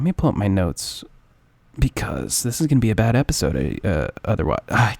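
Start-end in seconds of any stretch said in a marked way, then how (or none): none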